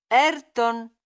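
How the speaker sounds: insistent call. Acted. Calling Ayrton repeatedly. ("Ayrton" chiamato molto più forte, con insistenza)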